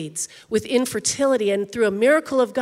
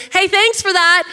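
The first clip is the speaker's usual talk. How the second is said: offhand flyby thanks; 6 semitones higher, 5 dB louder than usual. Retold or imitated. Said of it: "flyby thank yous where somebody goes".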